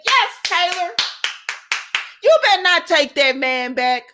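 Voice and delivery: wag a finger at her through the screen; warning Taylor. Real, not acted.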